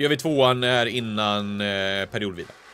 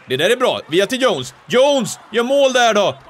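rising higher and louder to a yell; building to goal shout; 9 semitones higher, 7 dB louder than usual. Real, not acted. Gamer celebrating his team's goal, building shout. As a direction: erupt as the puck goes in.

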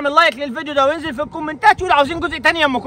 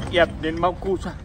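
deep gruff man voice; 10 semitones lower, 8 dB quieter than usual. Acted. The male character curses.

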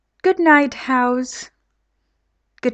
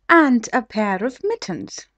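plain reading voice

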